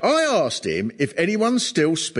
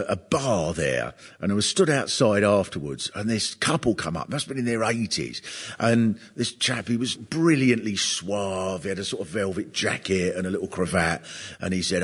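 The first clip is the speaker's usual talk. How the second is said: ordinary talk, nothing marked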